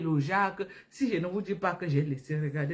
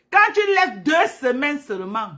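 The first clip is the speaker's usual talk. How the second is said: emphatic; 9 semitones higher, 9 dB louder than usual. Real, not acted. Insisting to viewers on skincare.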